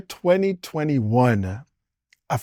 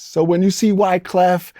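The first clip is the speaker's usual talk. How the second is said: ordinary talk, nothing marked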